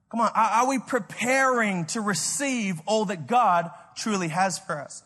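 ordinary talk, nothing marked

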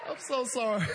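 loud words breaking into laughter; laughing apology. Real, not acted. Preacher apologizes to church for amusing himself, laughing. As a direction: apologize while cracking up. Preacher cracks up at own bit.

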